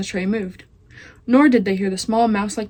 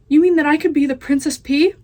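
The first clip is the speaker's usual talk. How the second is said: higher, louder excited question; thrilled; 6 semitones higher, 6 dB louder than usual. Acted. Meg hears she could be princess, excited question. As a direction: squeal it with hope.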